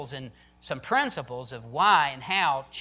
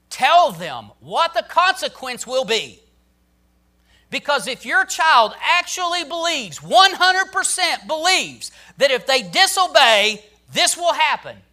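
much louder and higher, urgent preaching; preaching climax; 8 semitones higher, 10 dB louder than usual. Acted. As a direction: hammer it home to the congregation.

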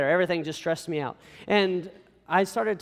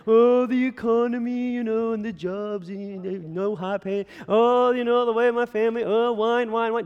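slow, high, drawn-out moaning complaint; hammed-up whiny grumbling on stretched vowels; mock whining; 4 semitones higher than usual. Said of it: "a lot of Christians whining and crying".

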